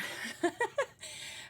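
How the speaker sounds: breathy little laugh; quick airy giggle. Real, not acted. Laughs after greeting chat.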